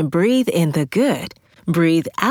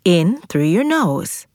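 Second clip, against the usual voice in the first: guided breathing. Acted. Leads belly breathing exercise.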